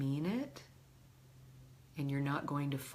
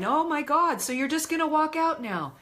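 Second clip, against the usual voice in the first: much higher and louder, outraged; incredulous shouted mock outrage; mock exasperated yell; 12 semitones higher, 8 dB louder than usual. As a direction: play the partner shouting after you. Other person yelling as you leave.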